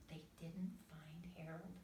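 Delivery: hushed sad whisper; whispered narration. A narrator's reading. Narrator reading children the sad moment, whisper. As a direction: let the worry sink in.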